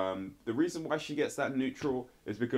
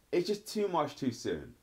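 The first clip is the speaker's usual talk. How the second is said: normal talking voice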